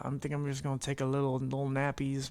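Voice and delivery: ordinary delivery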